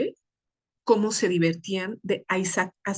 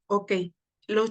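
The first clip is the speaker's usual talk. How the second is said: ordinary talk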